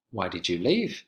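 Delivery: "leave" dropping low at the end; teacher showing a falling question pattern; falling question tune. Shown as a demonstration. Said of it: "interrogative sentences have two intonation patterns".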